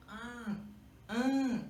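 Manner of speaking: "an" as one nasal A sound; A nasal com til. Shown as a demonstration. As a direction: say "an" as a single nasal vowel, no N closure. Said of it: "É um A com tio que vira". ("an" dito como um A nasal, com til)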